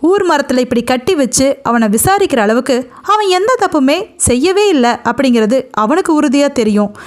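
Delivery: usual speaking voice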